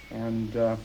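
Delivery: normal talking voice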